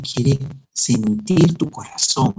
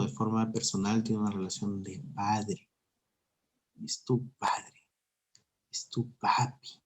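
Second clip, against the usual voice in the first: whisper; 4 semitones lower, 14 dB quieter than usual. Real, not acted. Preacher whispering to the listener that God is their papi. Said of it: "whispered intimate aside".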